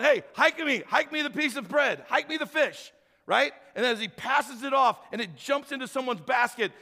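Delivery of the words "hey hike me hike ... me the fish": higher, louder, eager call for the ball; Jesus calling for the bread like a quarterback; playful quarterback. Retold or imitated. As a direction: call for the snap like a football game. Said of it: "I see Jesus going".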